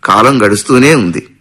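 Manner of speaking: usual speaking voice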